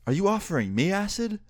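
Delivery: high-pitched retold disbelief; mock-stunned, high questioning tone; incredulous question. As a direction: ask it in astonishment, voice pitched well up.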